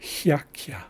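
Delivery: hiakia with a y-glide after h and k; Haisla word demo. Shown as a demonstration. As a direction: say hiakia as hya-kya, slowly and clearly. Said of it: "So first we have".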